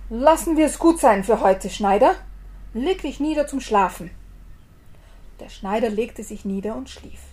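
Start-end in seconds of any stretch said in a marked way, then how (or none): 0.1-4.1 loud, high booming giant, hastily. Acted. Startled giant sends the tailor to bed, loud and high. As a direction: wave it off nervously but big. Frightened giant ends the day.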